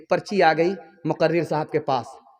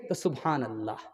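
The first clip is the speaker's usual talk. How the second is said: soft low reply; 8 semitones lower, 12 dB quieter than usual. Acted. Daughter answers mother's questions.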